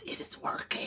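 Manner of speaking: gleeful conspiratorial whisper; giggling whisper. Real, not acted. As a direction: whisper the secret with a laugh. Gleeful secret about trip.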